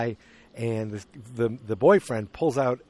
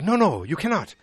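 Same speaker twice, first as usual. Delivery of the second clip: higher-pitched, emphatic mock refusal; acted firm refusal; 6 semitones higher, 3 dB louder than usual. Man refusing the academic guy a picture, firmly. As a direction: retell an insistent no, pitched up.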